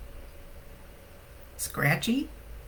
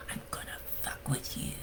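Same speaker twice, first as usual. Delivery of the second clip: soft unvoiced whisper, much softer; 13 dB quieter than usual. Sean's teasing threat whispered in Holly's ear. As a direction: breathe it like a secret in her ear. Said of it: "if he's saying it with a whisper".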